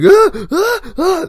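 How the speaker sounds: loud, very high mock panic yelps; freaked-out cries. Kid who hates blood in the ambulance, mock panicked cries. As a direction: imitate a kid squealing at blood. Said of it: "starts freaking out".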